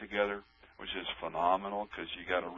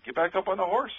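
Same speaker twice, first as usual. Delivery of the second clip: pep talk; 12 semitones higher, 3 dB louder than usual. Retold or imitated. Telling the general to retry.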